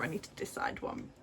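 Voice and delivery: hushed note to herself; whispered aside. Real, not acted. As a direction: say it under your breath, whispered.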